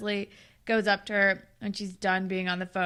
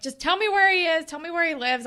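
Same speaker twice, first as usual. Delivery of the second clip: louder, higher mock insistence; demanding; 7 semitones higher, 7 dB louder than usual. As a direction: push hard for the answer. Claire demanding information.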